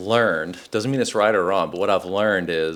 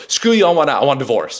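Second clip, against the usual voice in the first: higher, louder mock blow-up; hammed-up angry inner voice; mock angry outburst; 4 semitones higher, 6 dB louder, faster than usual. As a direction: fire off the knee-jerk reaction. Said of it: "my default still is".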